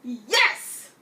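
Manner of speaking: loud cheering yes; excited yes. Retold or imitated. Fan cheering that Blue curses, loud excited yell. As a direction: punch the air as you yell it. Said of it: "I was like".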